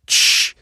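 a hushed unvoiced shush; soft shush. Andrea hushes the major before he answers, soft shush. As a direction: hush him with a quiet tsss. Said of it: "zniżając głos".

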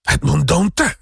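the prosecutor crying out the count's real name. A narrator's reading. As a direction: cry the name out in shock. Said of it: "krzyknął Wilford".

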